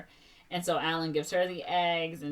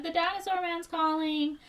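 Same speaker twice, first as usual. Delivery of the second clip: put-on high, sing-out little-kid voice; high-pitched toddler announcement; kid calling to mom; 11 semitones higher than usual. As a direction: call out like a small child.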